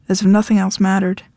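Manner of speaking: usual speaking voice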